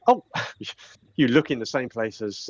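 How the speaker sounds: usual speaking voice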